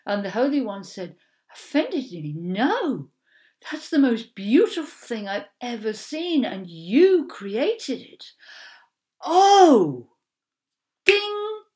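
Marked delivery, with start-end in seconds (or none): 1.5-8.3 louder, rising joy, slowing at the end; delighted exclamation. Acted. Holy One delighted by pearl. 9.2-10.1 high, loud amazed "Oh!"; loud gasp of wonder. Acted. Exclaims in wonder. 11.0-11.6 high sung bell "ding"; bright ding sound. Acted.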